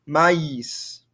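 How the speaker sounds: MAÏS as "ma-ees", both vowels heard; distinct vowels. Shown as a demonstration. Teacher repeating the word to show two vowel sounds, distinct vowels. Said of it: "they're very distinct".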